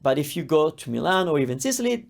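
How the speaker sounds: ordinary delivery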